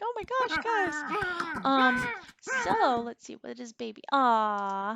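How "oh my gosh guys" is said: exasperated. Retold or imitated. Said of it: "I'm just like".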